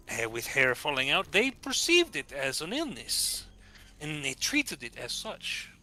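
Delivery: put-on lightly accented scholar; slow scholarly Spanish lilt.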